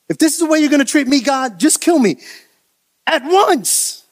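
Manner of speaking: high-pitched, worked-up protest; exasperated plea. Acted. Moses protests his treatment to God, exasperated plea. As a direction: rush in high, then punch the ending.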